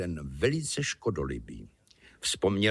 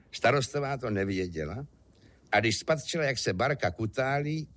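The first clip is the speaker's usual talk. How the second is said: usual speaking voice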